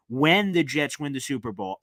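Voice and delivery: usual speaking voice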